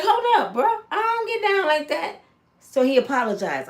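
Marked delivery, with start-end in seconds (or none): 0.0-2.2 high, affronted pushback; indignant refusal. Retold or imitated. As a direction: shut him down in shock.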